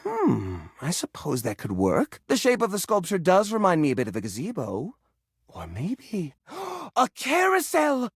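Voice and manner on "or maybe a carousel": gasp, then a slow, delighted reveal; light up at the idea; sudden idea. Acted.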